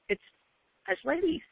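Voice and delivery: ordinary delivery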